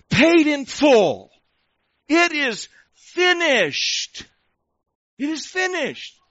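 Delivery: high, slow, drawn-out proclamation; emphatic declaration. Acted. Preacher voicing Jesus on the cross, emphatic declaration. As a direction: proclaim it word by word, loud at first, then easing. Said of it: "he says it with emphasis, the imperative".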